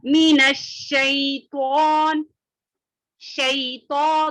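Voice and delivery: very slow, with शैतान drawn out and spread; heavy full ش in शैतान. Shown as a demonstration. Teacher showing students the spread sh sound, very slow. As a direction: stretch मिनश शैतान, letting the sh sound spread. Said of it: "तो मोटा".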